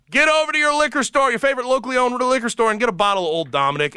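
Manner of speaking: high, fast pitchman energy; hyped ad read. Acted. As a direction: sell it big and bright.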